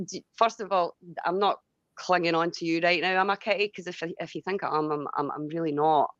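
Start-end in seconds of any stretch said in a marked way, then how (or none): none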